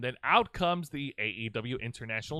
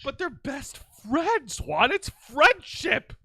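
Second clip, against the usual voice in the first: mock sentimental plea; 7 semitones higher, 6 dB louder, slower than usual. Teasing friend about hug tradition.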